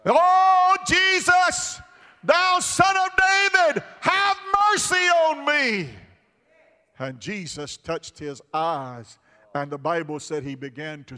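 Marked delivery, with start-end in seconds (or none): 0.1-5.9 high, loud desperate plea; blind beggar crying out. Acted. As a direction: cry out for mercy over the crowd.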